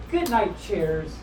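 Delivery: ordinary delivery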